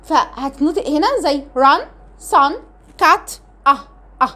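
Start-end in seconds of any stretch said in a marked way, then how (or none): 1.6-3.8 "ران، سان، كات" with a short "uh" vowel, then "اه" alone. Shown as a demonstration. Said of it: "لا اما اه". (نطق "ران، سان، كات" بصوت "أَه" قصير)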